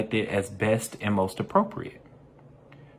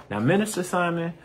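higher-pitched imitation of a pupil piping up; lightly acted pupil, pitched up; questioning young listener; 8 semitones higher than usual. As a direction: lightly put on a student's voice to interrupt the teacher. Voices a listener's puzzled question.

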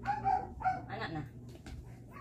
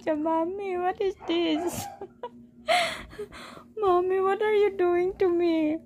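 high, whiny mock-crying pet voice; pet's voice; 8 semitones higher than usual. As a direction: speak as the whimpering pet. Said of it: "voicing the pet complaining".

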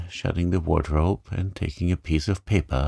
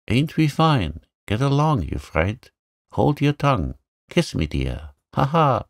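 high, swooping parrot exclamations; mimicking parrot; 6 semitones higher than usual. A narrator's reading. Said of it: "imitating her as well as he could".

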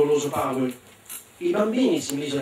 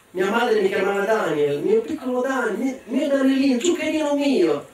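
mother's pet names; 6 semitones higher than usual. Acted. Ogre remembers mother's pet names.

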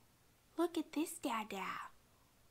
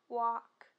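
ordinary delivery

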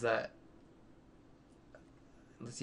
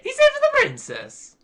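very high, loud squealed interjection; high cartoon voice; 9 dB louder than usual. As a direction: squeal it loudly over the other player. Repeats cartoon voice, interrupting again.

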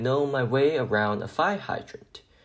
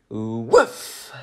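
a happy woof, louder; bark; 5 dB louder than usual. Acted. Arfie signing off his reply with a happy bark. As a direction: bark it like a wagging puppy.

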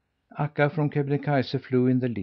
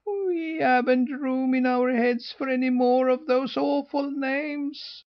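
shrieking goslings. A narrator's reading. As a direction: shriek it like fed-up little geese. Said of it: "shrieked the goslings".

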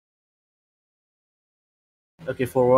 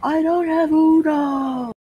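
very high, drawn-out cry; mock wail; much slower than usual. Player answering teammate about Uno, high mock wail. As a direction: wail it high and slow, hammed-up.

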